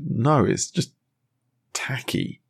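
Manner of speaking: dry Giles, last word breathed out; a hint of prissy disdain on tacky; lightly mocking Giles; Giles quote. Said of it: "Giles is".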